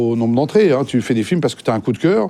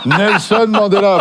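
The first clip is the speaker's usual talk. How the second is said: laughing correction; 6 semitones higher, 4 dB louder, slower than usual. Real, not acted.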